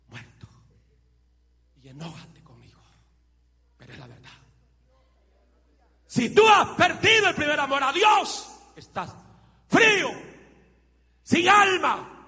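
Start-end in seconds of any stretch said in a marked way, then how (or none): 6.1-12.2 high, loud shout of the same line; preaching shout. Acted. Preacher warning lukewarm believers in a shout. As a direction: thunder the warning at full force.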